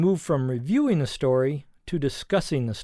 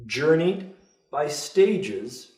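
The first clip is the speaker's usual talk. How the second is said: slower; much slower than usual. Shown as a demonstration. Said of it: "I'll say it a little more slowly".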